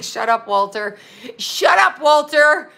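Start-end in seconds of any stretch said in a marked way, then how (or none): 1.4-2.7 high, loud mock yell of the name; mock shout. Viewers telling Walter off, mock shouted. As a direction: holler it like the whole chat is fed up. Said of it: "Everybody's like".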